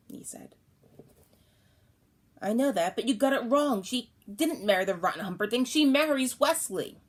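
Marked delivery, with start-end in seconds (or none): 2.4-7.0 higher, louder insisting; insistent child protest. Retold or imitated. Boy to father, insistent protest. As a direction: push back on dad.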